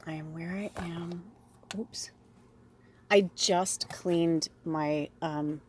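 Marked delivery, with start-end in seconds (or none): 0.1-1.3 whispered mantra. Shown as a demonstration. Host whispering the mantra back to herself, unvoiced.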